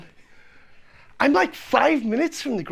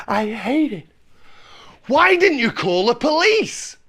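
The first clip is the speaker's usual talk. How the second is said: usual speaking voice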